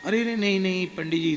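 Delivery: startled protest. Retold or imitated. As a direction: rush to stop him bowing. Guru stops devotee from bowing.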